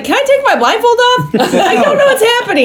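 high-pitched plaintive squeal, hammed up; mock bewilderment. Blindfolded wife asking the room what's happening, mock bewildered.

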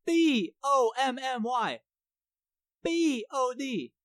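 letter by letter, slowly and evenly; spelled out slowly. Shown as a demonstration. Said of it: "That's T O N Y".

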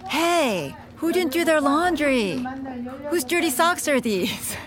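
calling out high across the house; bellowing. Retold or imitated. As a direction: holler at the family about the laundry. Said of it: "she bellows out".

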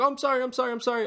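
high, hurried mock sorry; lightly voiced startled woman; mock flustered apology. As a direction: imitate her jumping and apologizing.

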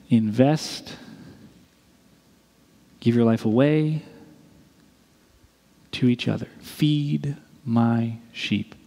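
tender, slowed closing charge; gentle. Retold or imitated. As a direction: lay it down gently, like a blessing. Jesus's final tender charge.

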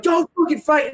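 high-pitched mock warning; urgent plea imitated. Onlookers telling the player not to fight, imitated urgent plea. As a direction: plead it high, as a worried bystander would.

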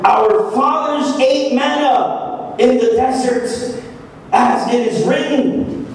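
slow, deliberate scripture reading. Shown as a demonstration. Preacher reading verse thirty-one to the church, slow reading. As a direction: read it slowly, letting each phrase land. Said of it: "gotta read the Bible slow".

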